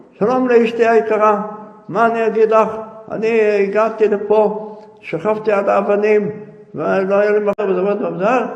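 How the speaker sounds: loud, high, harsh reading of the letter; stern angry reading. A narrator's reading. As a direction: bark the letter out impatiently. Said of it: "בתקיפות ובעצבים".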